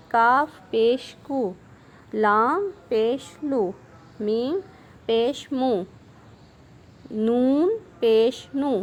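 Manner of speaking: slow, clear syllable-by-syllable reading; recitation drill. Shown as a demonstration. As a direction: read each letter slowly like a teacher. (آہستہ واضح ہجے)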